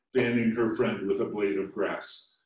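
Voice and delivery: plain reading voice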